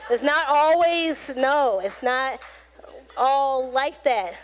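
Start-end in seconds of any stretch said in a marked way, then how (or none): none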